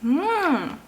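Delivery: normal talking voice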